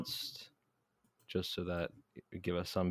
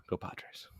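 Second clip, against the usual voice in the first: whispered, deflated "go Padres"; whispered cheer; 11 dB quieter than usual. Real, not acted. Host signing off after losses, whispered. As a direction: whisper it with no hope. Defeated sign-off.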